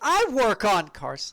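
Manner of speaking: high, loud, drawn-out mock boast; mock proud declaration. Voicing the mechanic asked about engineering, mock proud. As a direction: announce it slowly with hammed-up emphasis.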